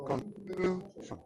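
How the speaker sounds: "Conclusion" slow, its middle syllable held high; stressed middle syllable. Shown as a demonstration. Teacher pointing out the stressed part, high middle syllable in "Conclusion". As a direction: stretch "Conclusion", pitching the second syllable up. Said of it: "has a kind of high pitch".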